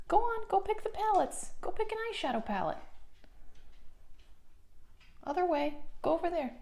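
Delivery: high sing-song pet talk; dog voice. Real, not acted. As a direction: coo at the dog, high and sweet. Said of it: "I do use my dog voice in this video".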